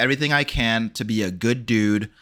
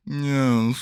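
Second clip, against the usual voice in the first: elf vampire impression; 2 semitones higher than usual. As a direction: drawl it like an affected elf vampire. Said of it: "he talks like this".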